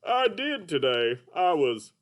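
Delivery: high, slow cartoon puppet voice; Mister Dolphin puppet. Puppet greets the kids as Mister Dolphin, high and slow. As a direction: play a sleepy, sing-song puppet. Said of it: "Mister Dolphin".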